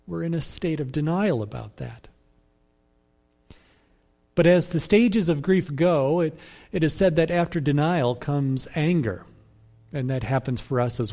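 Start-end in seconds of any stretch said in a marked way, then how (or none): none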